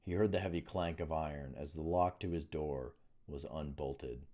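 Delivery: usual speaking voice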